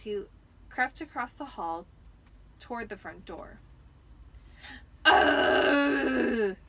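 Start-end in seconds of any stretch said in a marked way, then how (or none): 5.0-6.6 startled cry. A narrator's reading. Harry startled at the door.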